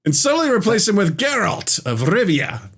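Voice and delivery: high, swooping, theatrical read; dramatic re-reading. Acted. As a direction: sell it like a grand pitch, pitched well up. Rereads comment after name correction.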